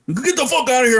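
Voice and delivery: high mock bouncer shout; pretend-mean yell. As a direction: play at throwing someone out. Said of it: "I'm gonna act like I'm being mean to you".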